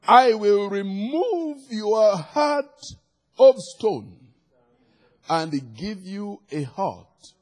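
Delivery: high, emphatic scripture proclamation easing at the end; full-force preaching. Real, not acted. Preacher declaring God's gift of a new heart, forceful preaching. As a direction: proclaim it with weight on each phrase.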